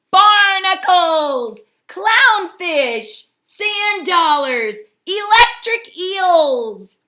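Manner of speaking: high, loud, slow excited list. Acted. Hermit Crab dreams up decorations for his plain shell, loud and high. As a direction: savour each name with growing delight.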